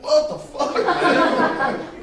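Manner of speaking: loud, high startled yell; man startled by a stranger yelling what the hell; startled outburst. Retold or imitated. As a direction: jump at a tap on the shoulder, shout it. Startled by tap on shoulder.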